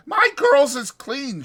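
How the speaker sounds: proud madam boasting; Susie quoted. Retold or imitated. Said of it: "she says".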